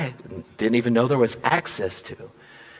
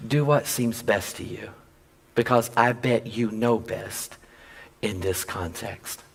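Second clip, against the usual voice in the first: gentle; 3 semitones lower, 6 dB quieter, slower than usual. Acted. Husband's gentle reply retold.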